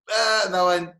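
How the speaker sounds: high, loud joking outburst. Shown as a demonstration. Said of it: "I can make a joke".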